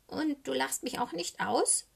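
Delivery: high, tentative raven question; cautious. A narrator's reading. Socke fears being laughed at, cautious. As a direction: ask carefully in a small high voice. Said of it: "fragt Socke vorsichtig".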